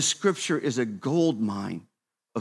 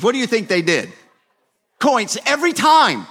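higher, louder animated storytelling; rising sermon energy; 8 semitones higher, 6 dB louder than usual. Acted. Pastor telling the congregation about his kids and coins, animated. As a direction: pump the room up with the story.

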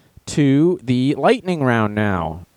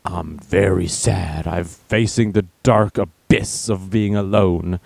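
low, slow monotone of gloom; mock despairing post; 5 semitones lower, slower than usual. User posting to Facebook, low mock gloom. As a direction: intone it slowly in a low, hammed-up misery.

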